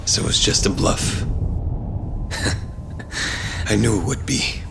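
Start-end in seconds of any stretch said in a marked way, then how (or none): 1.3-3.6 soft breathy dry laugh; a low scornful chuckle under the breath; quiet chuckle. Acted. Laughs at the bluff.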